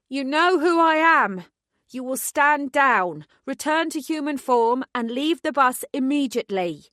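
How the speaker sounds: compel them with every word; commanding megaphone voice. A narrator's reading. Detective ordering werewolves off the bus, commanding. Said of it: "Every word thrummed with power".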